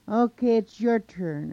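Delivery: loud, high handover; calling out. A narrator's reading. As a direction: holler it across to an opponent. Monster calls up to Coyote.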